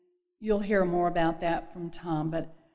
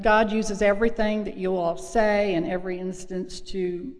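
usual speaking voice

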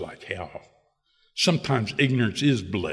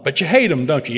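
the sponsor leans in on the point; sponsor confronting; 3 semitones higher, 7 dB louder than usual. Retold or imitated. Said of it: "He said".

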